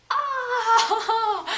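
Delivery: loud high laughing shriek; a laughing yelp of fright; startled shriek. Retold or imitated. Ostrich appears over hill.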